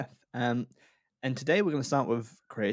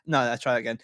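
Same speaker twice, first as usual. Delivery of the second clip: ordinary delivery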